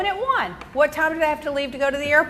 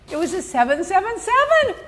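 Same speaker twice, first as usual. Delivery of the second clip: ordinary talk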